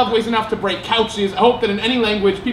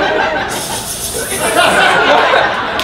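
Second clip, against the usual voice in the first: hissing unvoiced snake laugh; 10 semitones higher than usual. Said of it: "laughs like a snake".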